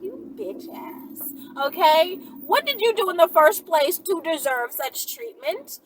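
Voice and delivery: ordinary delivery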